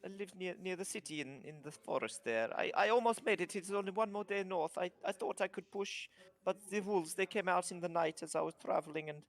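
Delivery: lightly voiced kobold with an Italian lilt, fretting; worried little trader dreading his papa's anger; mock Italian kobold, mildly upset; kobold's fretful accent. Kobold tells of his journey.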